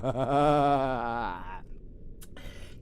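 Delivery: menacing drawn-out laugh; gloating villain laugh; villainous cackle. Acted. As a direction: cackle with dark glee.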